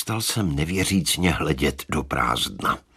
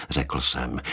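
ordinary delivery

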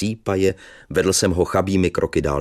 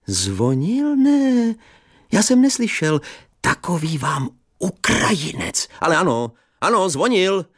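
put-on cheerful old teacher; 12 semitones higher than usual. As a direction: chatter fondly like a relieved old teacher. Teacher's fussy reply.

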